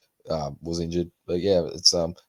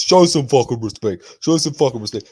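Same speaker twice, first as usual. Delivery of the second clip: loud, raised mock yell; Izzy yelling; 5 semitones higher, 6 dB louder than usual. Fighter at press conference, loud mock yell. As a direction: bark it like an angry fighter on stage. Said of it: "And then Izzy goes".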